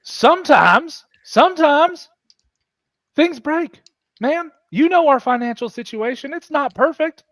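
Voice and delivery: hammed-up pleading whine; exasperated excuse. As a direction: plead your case in a strained high voice. Gage pleads with the angry captain.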